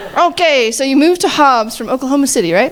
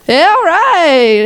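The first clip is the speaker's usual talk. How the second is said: higher, louder, slow drawn-out cheer; excited cheer; 6 semitones higher, 4 dB louder, much slower than usual. Real, not acted. Host spotting the coach's wife in the audience, excited cheer. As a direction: hype up the crowd.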